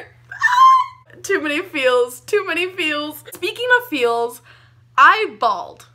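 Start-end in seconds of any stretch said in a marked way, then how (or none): 0.0-1.2 very high, loud squeal of feelings; overwhelmed squeal. Real, not acted. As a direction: shriek from too many feels. Overwhelmed by emotional scene.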